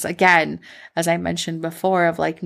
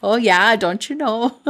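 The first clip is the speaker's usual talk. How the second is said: put-on playful, higher-pitched Minnesota accent; Midwestern accent; 5 semitones higher than usual. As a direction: lean into a jokey Midwestern accent. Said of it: "other than a Midwestern accent".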